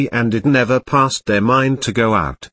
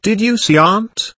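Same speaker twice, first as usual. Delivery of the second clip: thrilled; 9 semitones higher than usual. Acted. Vinka thrilled after sighting.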